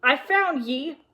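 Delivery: loud, high, drawn-out angry cry; villain's triumphant yell. A narrator's reading. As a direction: yell it slowly in accusation. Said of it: "yelled Mister Nye".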